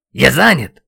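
loud, abrupt bark. A narrator's reading. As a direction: bark it while busy fighting. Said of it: "рявкнул я". (резкий рявк)

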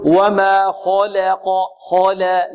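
slow وما خلق with la tilted toward le; over-thinned lam. Shown as a demonstration. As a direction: demonstrate the fault in خلق, lam so thin its a becomes e. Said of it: "يبالغ في ترقيق اللام".

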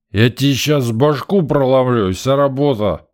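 loud slow menacing threat; drunken threat. A narrator's reading. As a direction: threaten him with a bottle.

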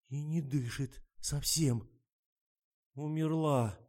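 slow, halting announcement; stunned dread. A narrator's reading. Lena finds her friend not breathing, stunned. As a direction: break it off in shocked pauses.

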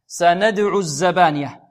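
recited slowly and loudly, in chanting style; recited verse. Shown as a demonstration. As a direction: chant سندع الزبانية as recitation. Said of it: "كلمة سندع فعل ماذا؟".

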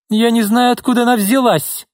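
furious denial, voice soaring; angry. Acted. Izolda angrily denies knowing her sister. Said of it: "гневно заявила Изольда".

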